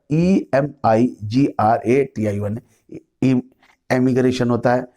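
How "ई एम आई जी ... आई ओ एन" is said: letter by letter, slightly slow: ई एम आई जी आर ए टी आई ओ एन; spelling it out. Shown as a demonstration. Teacher to students, spelling emigration letter by letter. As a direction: dictate each letter of ई एम आई जी आर ए टी आई ओ एन to the class. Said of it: "एक emigration होता है".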